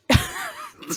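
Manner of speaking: high airy giggle; high breathy laugh. Real, not acted. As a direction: crack up mid-sentence. Laughing mid-story.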